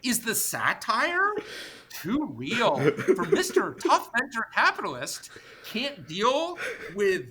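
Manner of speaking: slow, high, mock-pitying sarcasm; mock-sorry taunt at the tough venture capitalist; sarcastic mockery. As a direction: drag it out in fake sympathy, voice up high. Mocking the squeamish investor.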